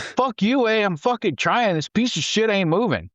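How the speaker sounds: high, snapping comeback; retold angry retort. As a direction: bite back at the gunner. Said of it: "and he was like".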